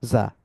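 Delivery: জা with a strong buzzing Z; hard জা. Shown as a demonstration. Teacher showing জা as a hard buzzing Z. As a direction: press the জা hard like an English Z. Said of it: "খুব শক্তভাবে যদি করেন".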